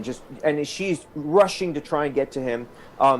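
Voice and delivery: ordinary delivery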